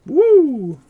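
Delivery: high loud whoop; excited whoop. Real, not acted. Gamer whooping at a play, loud cheer. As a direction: let out a big cheer.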